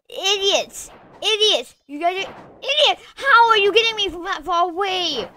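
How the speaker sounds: high, loud, fast frustrated yelling; angry rant. Real, not acted. Gamer yelling at opponents who keep killing him. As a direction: rage at the other players. Raging at players.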